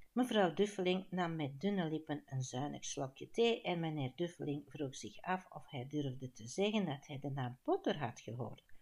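usual speaking voice

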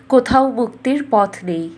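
ordinary delivery, nothing marked

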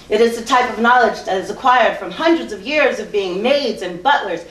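forceful, declamatory poetry performance; spoken-word poem. Acted. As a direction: deliver it as a pointed spoken-word piece. Recites a second poem.

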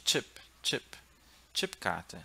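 "chip" with a crisp "tch" onset; English-style ch. Shown as a demonstration. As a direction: say "Chipkarte" starting like English chip. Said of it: "Como no inglês". ("chip" com tch, como no inglês)

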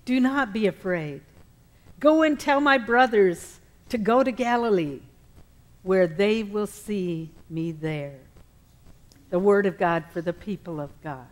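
normal talking voice

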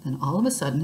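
plain reading voice

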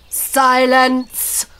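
loud, drawn-out troll boom; booming command. A narrator's reading. Troll leader silencing the little fairy, booming. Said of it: "boomed the voice of Doran".

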